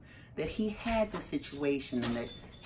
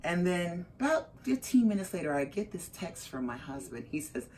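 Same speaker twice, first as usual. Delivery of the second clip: ordinary talk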